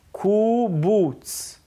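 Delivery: "קובוץ" split into two long oo syllables; drawn-out "קובוץ" for learners to hear; sound demonstration. Shown as a demonstration. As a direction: say "קובוץ" very slowly, holding each oo. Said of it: "ככה קוראים".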